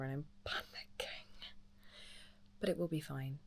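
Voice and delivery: soft hushed whisper. Real, not acted. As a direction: whisper so nobody wakes. Whispering late at night.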